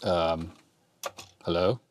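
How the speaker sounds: normal talking voice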